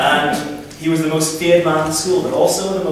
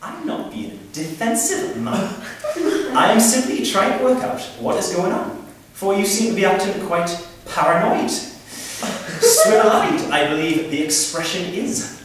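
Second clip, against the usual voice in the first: laboured, over-articulated Geordie with mock gravitas; slow, phonetic Geordie; 4 semitones higher than usual. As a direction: spell it out slowly, pitched up, for gravitas. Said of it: "speak very slowly and very phonetically".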